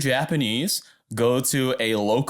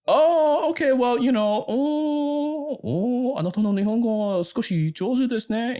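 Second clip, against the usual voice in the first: gushing Japanese native speaker; 11 semitones higher than usual. Retold or imitated. Locals flattering a learner's Japanese, gushing high. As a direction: coo it high, stretching the vowels. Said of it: "they're gonna be like".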